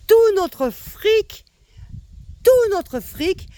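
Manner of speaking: high, drawn-out indignant cry; outraged. Real, not acted. Ranting at Macron sucking up their money, outraged. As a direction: stretch each word out high in outrage.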